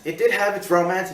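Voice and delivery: usual speaking voice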